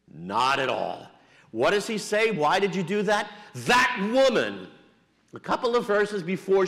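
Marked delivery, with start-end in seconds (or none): 2.3-4.7 blaming Adam. Acted. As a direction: lean hard on that woman, dodging the blame. Adam blaming God and Eve.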